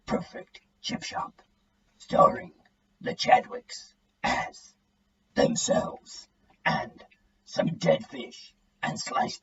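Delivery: gravelly American trailer announcer, hushed and slow; gruff movie trailer voice. A narrator's reading. Dad hamming up an American movie-trailer voice for the family. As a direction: growl it like a film advert voiceover in an American accent. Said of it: "speaks in that gruff American accent they use on film adverts".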